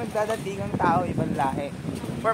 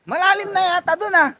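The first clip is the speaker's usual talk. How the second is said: excited; 9 semitones higher, 8 dB louder than usual. Real, not acted. Entering deep water.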